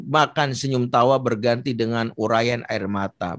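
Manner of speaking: normal talking voice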